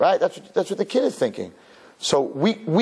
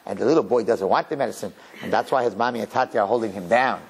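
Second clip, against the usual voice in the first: ordinary talk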